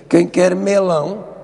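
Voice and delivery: waiter's Brazilian-style call, slow and drawn out; imitated Brazilian accent. Waiter at dinner offering melon, imitated Brazilian accent. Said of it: "o empregado dizia".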